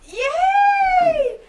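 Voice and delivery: squealed high yay; excited yay. Acted. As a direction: shriek it with joy. Improv character cheering.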